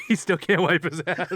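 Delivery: real laughter breaking into the words; laughing. Real, not acted. Host jokes about injured player, laughing through it. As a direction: barely hold the laugh in while talking. Cracking up at own joke.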